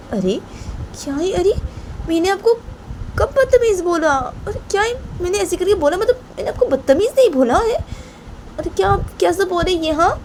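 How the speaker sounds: high-pitched, pleading self-defence; protesting Rahma. Acted. Rahma insists she never insulted her elder, protesting.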